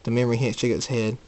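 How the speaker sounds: ordinary delivery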